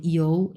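ইউ as a low "i-ou" glide; low demonstration of ইউ as "iou"; model iu sound. Shown as a demonstration. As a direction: glide ইউ through "you" with an o sound. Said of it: "এরকম".

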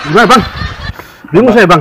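gasping through the heat; words squeezed out past burning chili; mouth burning from spicy food. Real, not acted. Eating very spicy noodles.

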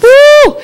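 high whooping woo; loud high whoop. Real, not acted. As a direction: let out a high cheer. Exclaims about prayer life.

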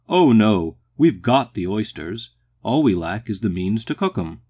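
loud, hearty boom; booming, genial walrus. A narrator's reading. The walrus explains what they lack, hearty boom.

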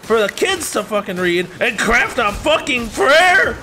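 high-pitched, loud yelling tirade; furious rant. Acted. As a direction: let loose an outraged, screamed rant. Acted imagined rant back at teacher.

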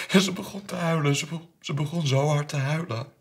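high, loud, agitated narration; distraught telling. Acted. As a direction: relive the scene in distress. Distraught retelling of daughter crying. (ontdaan, met verheven stem)

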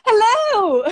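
high, loud, delighted hello; excited greeting. Real, not acted. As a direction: greet them with a squeal of relief, laughing. Guest finally connects on the call.

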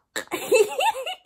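high-pitched squealing giggle. Real, not acted. Vlogger mocking a seven o'clock bedtime, high giggle. Laughs at his weak excuse.

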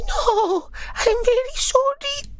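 very high, drawn-out squeaky plea; tiny germ begging in a squeal; high-pitched pleading little creature; apologetic bacteria. Acted. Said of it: "the bacteria says".

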